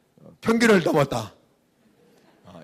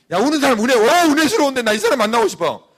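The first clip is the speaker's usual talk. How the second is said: higher, louder mock excitement; mock delight; 7 semitones higher, 5 dB louder than usual. Preacher mocking someone delighted to meet a crier, ironic excitement. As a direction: gush ironically. Mock eager about crying person.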